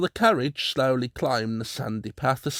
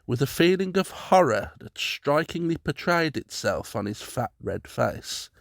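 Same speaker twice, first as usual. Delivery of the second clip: usual speaking voice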